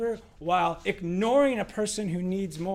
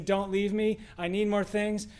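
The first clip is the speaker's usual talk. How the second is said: ordinary talk, nothing marked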